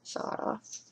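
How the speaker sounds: breathy whisper of saw it off; whispered aside; whispered repeat. Shown as a demonstration. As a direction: hush it right down. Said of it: "repeats phrase in a whisper".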